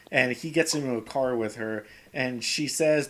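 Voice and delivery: usual speaking voice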